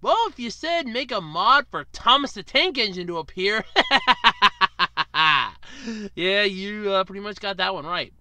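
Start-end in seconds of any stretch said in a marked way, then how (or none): 3.7-6.1 laughing. Real, not acted. Laughs at Thomas mod.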